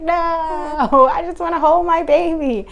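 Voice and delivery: high crying plea; mother pleading to hold her baby; tearful plea. Retold or imitated.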